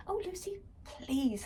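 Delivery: high, exasperated mum; impatient. A narrator's reading. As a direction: sigh it out impatiently. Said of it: "her mum started impatiently".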